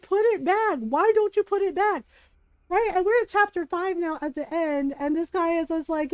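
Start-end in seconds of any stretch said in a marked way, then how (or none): 0.0-2.0 urging. Real, not acted. Yelling at game character.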